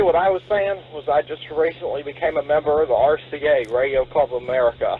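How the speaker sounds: ordinary talk, nothing marked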